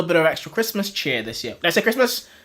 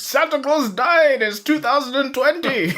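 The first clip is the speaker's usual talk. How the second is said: Dead Santa voice; 9 semitones higher than usual. Acted. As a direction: announce yourself as a ghostly Santa. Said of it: "impression of Dead Santa".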